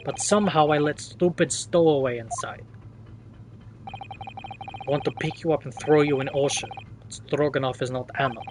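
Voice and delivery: lightly voiced Russian sailor, grumbling threat; a hint of a surly Russian seaman; Russian accent sailor. Sailor threatening to throw stowaway overboard, light Russian accent.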